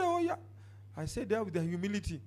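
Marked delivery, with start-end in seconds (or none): 0.0-0.3 much higher surprised yelp; startled oh. Retold or imitated. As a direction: react as if caught off guard. Preacher's startled reply.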